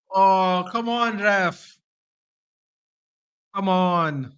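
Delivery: pleading with the referee. Real, not acted. Fan pleads against referee call.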